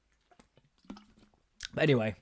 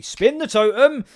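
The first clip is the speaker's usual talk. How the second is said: exasperated outburst; 9 semitones higher, 8 dB louder, slower than usual. Real, not acted.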